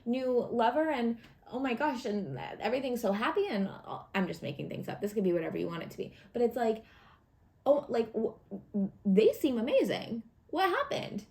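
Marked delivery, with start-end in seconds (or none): 0.0-3.5 high-pitched gossipy astonishment; acted amazement, pitched up; amazed onlookers. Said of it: "everyone's gonna be like". 7.7-11.2 stammering disbelief, rising high at the end; acted astonishment; amazed onlooker.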